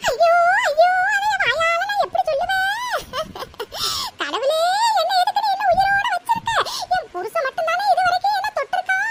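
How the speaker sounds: high, tearful outcry to God; hammed-up lament broken by sobs; wailing and sobbing. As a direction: bawl like a wronged old woman. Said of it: "அலறதை நிறுத்திட்டு".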